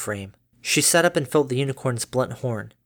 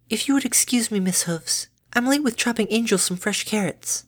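high, gentle pony voice; lightly voiced shy mare; 9 semitones higher than usual. Fluttershy voiced in story.

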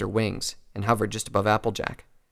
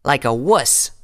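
sneering taunt, pitched up and louder; Rainbow's sneer; 4 semitones higher, 6 dB louder, slower than usual. A narrator's reading. Rainbow goading Applejack, sneering insult. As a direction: jeer through a curled lip. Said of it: "Her lips curled into a sneer".

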